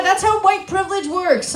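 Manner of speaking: ordinary talk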